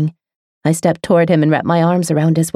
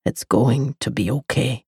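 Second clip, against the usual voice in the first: lower, softer male voice; soft low reassurance; 4 semitones lower, 6 dB quieter than usual. A narrator's reading. The lover reassuring the girl, soft and low. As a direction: reassure her quietly.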